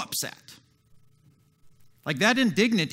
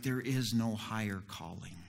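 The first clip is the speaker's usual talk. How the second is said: much lower and much softer, calm and kind; Jesus, gentle; 11 semitones lower, 12 dB quieter than usual. Retold or imitated. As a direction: answer low and quiet, like a patient Jesus. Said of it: "And Jesus is like".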